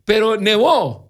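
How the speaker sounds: put-on high, loud whiny kid protest; childish protest. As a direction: complain like a wronged boy shouting back. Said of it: "y yo como niño quejándome".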